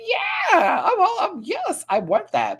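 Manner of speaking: eager squeaky mock Lydia, barely holding it together; Lydia's voice. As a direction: squeal it through the giggles. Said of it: "Lydia's like".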